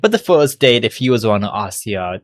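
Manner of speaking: normal talking voice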